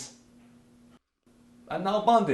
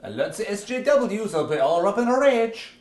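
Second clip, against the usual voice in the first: rising into a loud mock alarm; 5 semitones higher, 7 dB louder than usual. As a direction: sound the alarm, hammed up. Mocking outraged activists.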